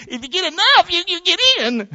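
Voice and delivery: mock the idea of weighing good works; lightly mocking. Preacher mildly mocks the giant scales belief.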